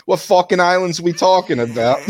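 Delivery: high-pitched retold Brooklyn accent. Debater retelling a stand-up bit, in a high Brooklyn accent. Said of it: "the guy's from Brooklyn".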